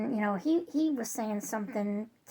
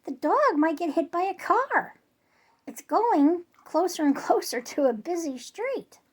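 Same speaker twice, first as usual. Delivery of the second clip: insistent; 6 semitones higher, 5 dB louder than usual. Retold or imitated. Arguing back with boyfriend.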